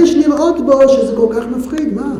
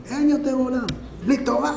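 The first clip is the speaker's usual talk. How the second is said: quiet lament; 9 dB quieter than usual. Shown as a demonstration. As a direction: say it softly, as if to oneself. Quiet first take of lament.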